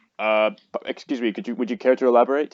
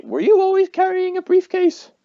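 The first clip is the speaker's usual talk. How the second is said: incredulous; 7 dB louder than usual. Acted. Player suspicious, squeaky question.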